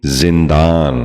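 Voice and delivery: زندان with short i first, "zin-daan"; correct زندان. Shown as a demonstration. Teacher modelling for viewers, زندان said as "zindaan". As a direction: start زندان with zin, not zan. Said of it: "آپ بھی غور سے سنیے".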